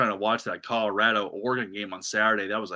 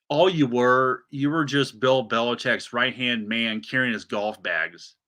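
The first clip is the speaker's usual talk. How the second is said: normal talking voice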